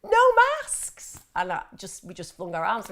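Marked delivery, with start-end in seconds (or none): 0.0-1.2 high, loud mock yell; loud, high-pitched exclamation; retold shout. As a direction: shout it like an outburst. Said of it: "the girl went".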